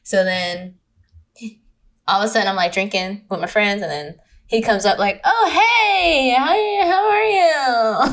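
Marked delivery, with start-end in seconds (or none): 5.2-8.1 high, drawn-out mock greeting; exaggerated sing-out hello, slowing at the end; his greeting voice. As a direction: imitate him with a long, high "hey". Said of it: "that's how he talks".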